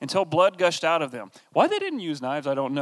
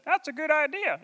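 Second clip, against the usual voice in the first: much higher, cartoonish vegetable chorus; VeggieTales vegetable voice; 12 semitones higher, 5 dB quieter than usual. The crowd of people agreeing, in a high cartoon vegetable voice. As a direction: squeak it like a cartoon gourd. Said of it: "the people's voices as ... characters from VeggieTales".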